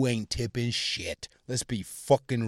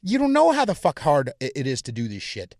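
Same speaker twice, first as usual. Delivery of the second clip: usual speaking voice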